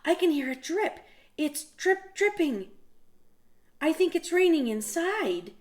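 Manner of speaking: alarmed tiny voice; worried Little Mouse. Acted. Little Mouse hears a drip.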